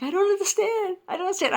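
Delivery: high-pitched mock whimper; imitating tearful crying. Upset test-taker pleading with the guard, mock tearful. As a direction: act out a teary, high-voiced protest. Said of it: "I'm starting to cry".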